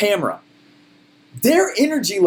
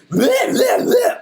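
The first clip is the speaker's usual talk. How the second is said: mocking hype noise; much slower than usual. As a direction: imitate obnoxious American hype as yelled gibberish. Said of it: "have to be like".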